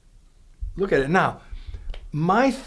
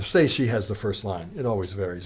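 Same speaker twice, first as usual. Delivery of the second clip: ordinary delivery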